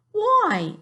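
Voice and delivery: young Sophie in a put-on English accent. Teacher reading to her class as Sophie, English accent. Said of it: "an English accent".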